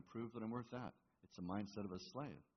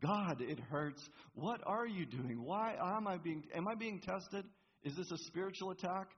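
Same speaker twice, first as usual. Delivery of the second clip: honest plea; 10 semitones higher, 5 dB louder than usual. Shown as a demonstration. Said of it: "Do you see the difference?".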